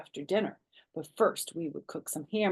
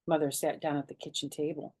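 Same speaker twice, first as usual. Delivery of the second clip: ordinary delivery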